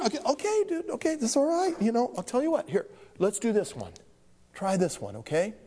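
put-on calm a crying child down; soothing teacher.

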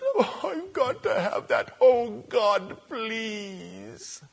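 high, soft whiny begging; whining plea. Acted. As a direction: mock-desperate high whimper. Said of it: "screaming and whining and moaning".

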